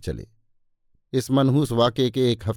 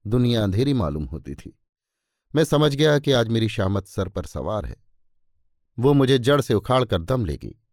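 usual speaking voice

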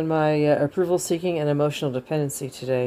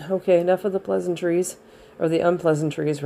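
normal talking voice

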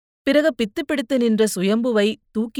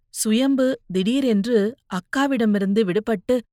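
ordinary delivery, nothing marked

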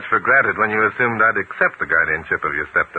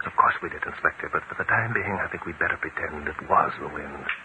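low, hushed conspiratorial murmur; hushed aside; 4 semitones lower, 7 dB quieter than usual. Acted. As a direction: keep it down so he can't hear. Aside so the host won't hear.

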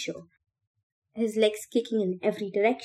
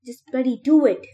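higher, louder exasperated order; forceful raised-voice command; yelled; 5 semitones higher, 8 dB louder than usual. A narrator's reading. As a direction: yell it mid-struggle. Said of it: "Newt yelled".

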